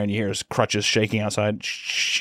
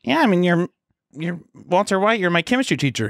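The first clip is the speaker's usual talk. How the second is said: pitched-up innocent student; high girl voice; 7 semitones higher than usual. Retold or imitated. Student answering Walter, high and bright. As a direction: state it brightly.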